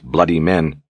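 ordinary delivery

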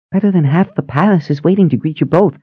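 put-on high, quick, eager old sister; bubbly high-pitched sister asking. A narrator's reading. Phoebe voiced by narrator.

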